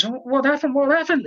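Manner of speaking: put-on rising alarm over her boy; alarmed mother. Mother finding James out cold, high and panicked. Mother finds son knocked out.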